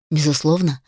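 ordinary delivery

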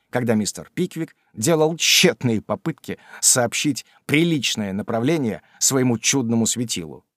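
ordinary delivery

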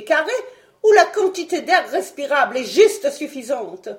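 tirade indignée, plus haute et plus forte; colère indignée. Acted. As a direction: gronder d'indignation. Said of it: "rouge de colère". (le savant s'indigne de l'imprudence de Fred, furieux)

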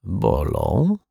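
wary, tentative answer rising at the end; guarded murmur with a questioning lilt; cautious mumble. A narrator's reading. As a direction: admit it carefully, half asking. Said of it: "mruknął ostrożnie pan Mietek".

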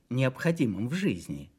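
ordinary delivery, nothing marked

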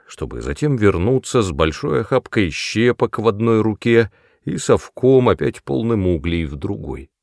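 ordinary delivery

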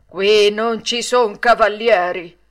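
slow, loud, stern rebuff; harsh reply. A narrator's reading. Puppet master rejects the flattering title, harsh, slow and loud. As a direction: refuse it hard, word by word. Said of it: "replicò duramente il burattinaio".

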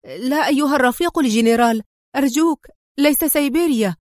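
high-pitched, pleading dread; acted horror, frantic begging; terrified cry. A narrator's reading. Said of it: "أطلق أندري صرخة رعب وصاح".

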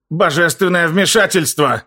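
bubbling gurgle, words choked wet; gurgling. A narrator's reading. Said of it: "пробулькал Дестур".

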